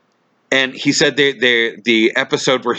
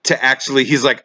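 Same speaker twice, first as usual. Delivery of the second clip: ordinary delivery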